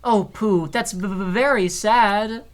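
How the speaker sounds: high, stammering, slow and sad; sad Piglet voice. Acted. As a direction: stutter it out like a sad tiny piglet. Voicing Piglet, stammering sadly.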